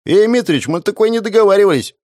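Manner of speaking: high, fast alarmed shout; panicked bandit yell. Acted.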